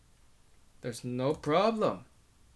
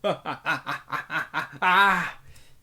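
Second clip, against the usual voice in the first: long, loud uncontrollable laughter; idiotic laugh; 2 semitones higher, 5 dB louder than usual. Acted. The narrator laughs like an idiot instead of yelling. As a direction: laugh like an idiot and can't stop. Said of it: "I start to laugh like an idiot".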